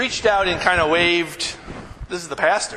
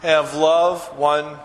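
drawn-out recitation; 3 dB louder, much slower than usual. Acted. Congregation reciting verse.

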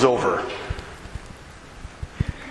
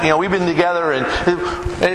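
normal talking voice

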